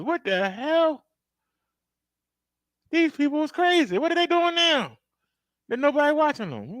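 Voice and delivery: high, shocked gasping at the window; high-pitched disbelief; shocked onlooker. Retold or imitated. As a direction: react like you can't believe your eyes. Peeking through the window, shocked.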